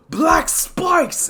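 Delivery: high, loud battle cry; attack call. Acted. Izuku calling out his attack, high and loud. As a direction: shout the move name.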